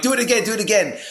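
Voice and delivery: high, loud amazed plea; like a kid after a magic trick; excited. Retold or imitated. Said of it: "I'm like".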